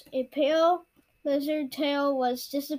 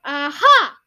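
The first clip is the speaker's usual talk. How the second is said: loud, pitched-up pouncing shout; sudden yell; 11 dB louder than usual. A narrator's reading. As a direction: burst out with a triumphant yelp from behind. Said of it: "yelled a voice behind him".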